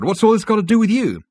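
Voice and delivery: high, loud, exasperated challenge; demanding. A narrator's reading. Zaphod challenges Rooster's involvement, high and loud. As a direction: throw the question out forcefully, voice soaring. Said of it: "demanded Zaphod".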